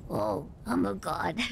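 lightly voiced cocky anime boy. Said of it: "Boy's like".

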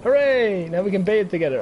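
high, loud happy cheer; delighted cheer. Real, not acted. Player cheering as the gazelle bathes, high happy cheer. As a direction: celebrate the little win.

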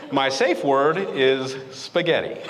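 ordinary delivery

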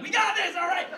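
high, loud bravado shout; mock heroic yell. Teenager pretending he can save the plane, loud yell. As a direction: holler it as if out a plane window.

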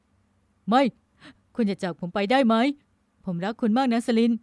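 loud, distressed sleep-talk; pleading lament. A narrator's reading. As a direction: plead out loud from inside a nightmare. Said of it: "เสียงพร่ำรำพัน".

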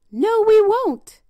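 high, loud, slow squeaky pigs; little pigs voice. A narrator's reading. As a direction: refuse it like stubborn piglets. Pigs answer the wolf.

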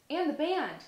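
cried out. A narrator's reading. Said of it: "cried Olivia".

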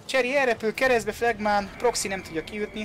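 high, loud goal call; excited hungarian sports commentary. Real, not acted. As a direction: shout the goal as it happens. Goal call in live match.

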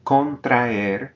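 Contraer with a and e split; syllables separated. Shown as a demonstration. Teacher models Contraer, vowels split apart. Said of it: "we're separating that aer".

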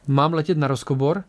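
ordinary delivery

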